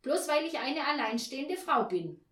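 high, loud angry tirade; indignant interruption. A narrator's reading. Neighbour talks over the landlady, indignant interruption. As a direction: cut in loudly, refusing to be pushed around. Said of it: "rief Frau Janka dazwischen".

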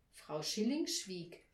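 ordinary delivery

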